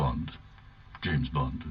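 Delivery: low, suave spy voice; deep, smooth secret agent; James Bond. Acted. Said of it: "do my James Bond impersonation".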